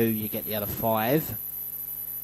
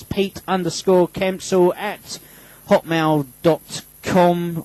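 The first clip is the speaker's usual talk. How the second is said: spelled out slowly; 6 semitones higher, 5 dB louder, slower than usual. Real, not acted. Dictating email address on air.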